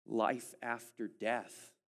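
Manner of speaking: drawn out slowly; slow emphasis. Real, not acted. Preacher slowing to stress life after death. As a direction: let each word land slowly. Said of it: "slowed climax".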